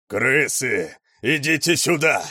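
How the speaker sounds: loud, high bellow; raging roar. A narrator's reading. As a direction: bellow a challenge. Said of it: "раздался рёв повара".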